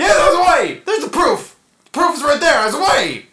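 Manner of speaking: very high, full-volume yelling; frantic mock shouting. Suspect asked for proof of alibi, frantic mock screaming.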